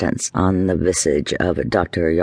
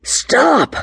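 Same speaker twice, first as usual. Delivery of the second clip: shout. A narrator's reading. Johanna shouting at the drunkard to stop, high commanding shout. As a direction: bark it out to halt the beating.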